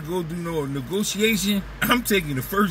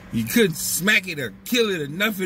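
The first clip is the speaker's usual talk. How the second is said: normal talking voice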